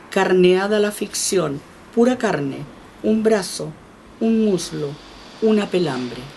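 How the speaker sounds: slow, weighty reading pressing on each image; restarting the poem with a fuller voice so the back can hear. Acted. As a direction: declaim the poem to the back rows. Yo tengo la voz baja de pronto.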